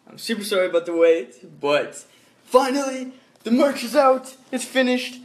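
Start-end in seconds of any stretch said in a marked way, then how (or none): 2.4-5.2 high excited announcement. Real, not acted. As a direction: announce it with a lift.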